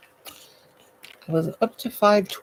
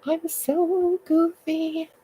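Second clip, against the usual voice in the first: high, drawn-out silly voice; goofy voice; 11 semitones higher, much slower than usual. Pretend. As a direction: ham it up slowly, pitched way up. Repeats it in goofy voice.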